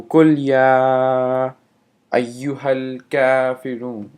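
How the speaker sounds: قل يا أيها الكافرون with the vowels stretched long; drawn out vowels. Shown as a demonstration. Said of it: "stretch out the vowels".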